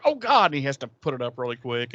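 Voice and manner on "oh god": startled. Retold or imitated. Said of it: "he's like".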